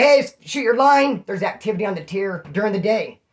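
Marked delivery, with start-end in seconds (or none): 0.0-1.2 calling out. Retold or imitated. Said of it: "But you can say".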